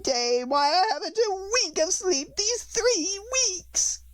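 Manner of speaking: very high, very slow bird voice; fretful pigeon. A narrator's reading. Pigeon grumbles past Alice, high and slow. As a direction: drag out the complaint like a fussy pigeon.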